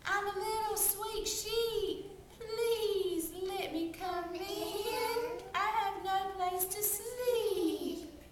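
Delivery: disguised wolf begging the pigs, slow and high; wolf as sweet sheep. Acted. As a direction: draw it out sweetly like a harmless lamb. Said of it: "the little sheep said".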